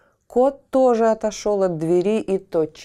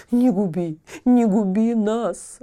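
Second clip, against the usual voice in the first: жалобное хныканье, выше; лёгкое нытьё, умоляя; жалобно; 5 semitones higher, 5 dB louder, slower than usual. A narrator's reading. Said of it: "жалобно хныкал Сахар". (Сахар жалобно просит Тильтиля не губить их)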